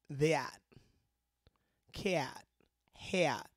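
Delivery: "that", "Cat", "Hat" with the A broken into ee-ah; Cleveland vowel. Shown as a demonstration. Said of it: "There's certain vowel sounds".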